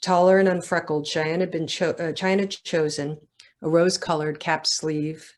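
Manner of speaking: measured, literary reading voice; story narration. A narrator's reading. Author reading her novel to a live audience, measured narration. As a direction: read it like an author at a bookstore event.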